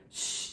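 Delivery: a soft, sharp shush; a quick warning shh. A narrator's reading. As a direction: hush them at once. Eric hushing his men.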